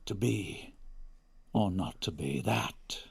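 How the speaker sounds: low, slow, quiet dirge declamation; Shakespearean dirgy voice. Pretend. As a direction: intone it slowly like a gloomy bard. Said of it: "my Shakespearean dirgy voice".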